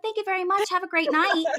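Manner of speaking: high, sweet drive-through politeness; sugary polite thanks to the cashier; customer service voice. Retold or imitated. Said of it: "That's called my customer service voice".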